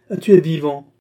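ricanement moqueur, plus fort; ricanant. A narrator's reading. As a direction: se moquer de lui, plus haut. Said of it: "ricanait-il". (Grimbaud ricane devant Mathias, moqueur)

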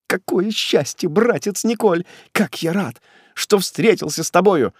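high, ingratiating delight; feigned, fearful joy. A narrator's reading. Condemned man fawning on executioner brother, feigned joy. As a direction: fawn with nervous gladness. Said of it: "с притворной и боязливой радостью".

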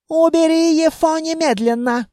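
high, strained officer's outcry; mild yell. A narrator's reading. Elf orders the man removed, high mild yell. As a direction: pitch it up into an irate order. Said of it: "заорал эльф".

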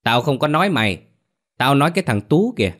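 strident scolding. A narrator's reading. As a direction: scold with your neck straining. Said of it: "gân cổ lên chửi".

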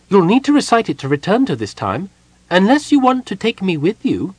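high, loud excited Kender pitch. A narrator's reading. Tass excitedly hints he should go along. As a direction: bubble over hoping to come along. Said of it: "he added excitedly".

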